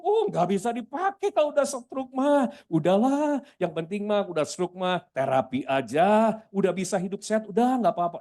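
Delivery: mock resigned advice in a high voice; voice of public opinion. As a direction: mimic someone writing off the sick. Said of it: "Itu opini umum".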